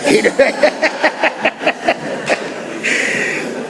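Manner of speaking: wheezing belly laugh, trailing out; real laugh. Real, not acted. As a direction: laugh long at your own joke. Laughs at his own joke.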